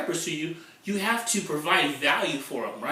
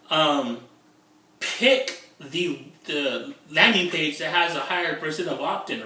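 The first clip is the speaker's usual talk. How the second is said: ordinary talk, nothing marked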